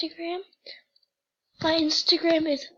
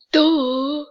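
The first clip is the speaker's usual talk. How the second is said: shouted sarcastic duh; mock-obvious yell; loud duh; 9 dB louder than usual. Real, not acted. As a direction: blurt out duh like it's obvious.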